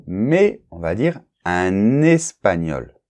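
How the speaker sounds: un espagnol linked as un-n-espagnol, slowly; liaison modelled. Shown as a demonstration. Teacher slowly models the liaison in un espagnol. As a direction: say un espagnol slowly with the n carried over. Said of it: "Donc attention".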